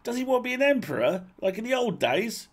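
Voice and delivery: high quick voice full of alarm; Spindle. A narrator's reading. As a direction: rush the question out in a high excited voice.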